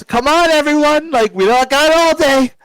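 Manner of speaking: high, loud impatient call; high-pitched rallying shout; yelling. Acted. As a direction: yell down to hurry the group along. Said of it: "he just yells".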